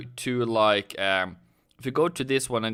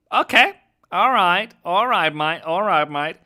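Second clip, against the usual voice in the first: louder, higher, bouncy hello; cheery greeting; 6 semitones higher, 7 dB louder than usual. Real, not acted. Streamer hailing a viewer in chat, loud and cheery. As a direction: greet a mate with a burst of energy.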